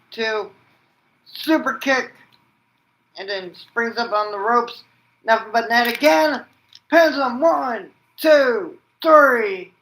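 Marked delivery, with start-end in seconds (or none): 1.3-9.7 higher and louder call building to the three count; hyped play-by-play. Acted. Calling the finishing sequence.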